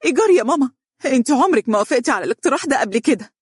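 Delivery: high, loud, tearful indignation; fast, aggrieved daughter's outburst; exasperated. A narrator's reading. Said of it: "قالت دنيا بحنق وهي تجفف دمعها".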